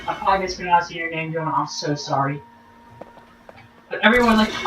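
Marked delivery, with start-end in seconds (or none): none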